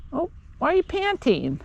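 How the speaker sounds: ordinary talk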